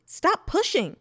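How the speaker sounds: high, loud protest from the crowd; indignant yell. A narrator's reading. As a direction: yell it, annoyed at being jostled. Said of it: "Still others yelled indignantly".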